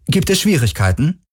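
put-on high, sing-song girl's voice; soft high-pitched inquiry; soft, melodic voice. A narrator's reading. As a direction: ask sweetly in a high, melodic lilt. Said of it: "eine weiche, melodische Stimme".

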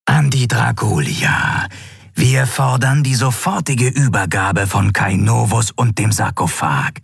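eerie bodiless broadcast; hissing disembodied voice. A narrator's reading. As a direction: intone the threat, unwavering. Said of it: "zischte die körperlose Stimme".

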